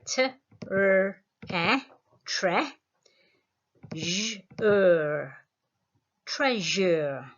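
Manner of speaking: teacher checking the answer aloud; sounding out answer. Shown as a demonstration. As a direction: say T, r, e, tre, j, er, each part spaced out. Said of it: "You should have something like this".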